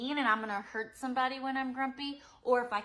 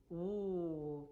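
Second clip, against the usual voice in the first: surprised gasp; 2 semitones lower, 4 dB quieter than usual. Shown as a demonstration. Teacher reacts to scared face.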